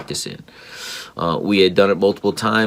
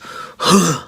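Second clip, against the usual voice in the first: frustrated sigh; 10 semitones higher than usual. Retold or imitated. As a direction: let out a fed-up child's huff. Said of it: "just frustrated".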